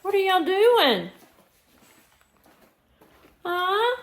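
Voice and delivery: high, loud doggy-greeting voice; pet talk. Real, not acted. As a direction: sing it up high to the dogs. Greeting the dogs.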